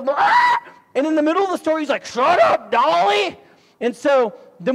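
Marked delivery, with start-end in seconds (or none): 0.0-0.6 loud, high-pitched parrot screech; sudden piercing squawk, imitating a parrot. Parrot interrupting a man's sad story, loud squawk. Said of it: "the parrot goes". 2.0-3.4 loud, raised-pitch scolding; acted angry yell. Man interrupting his story to silence his parrot, mock angry yell.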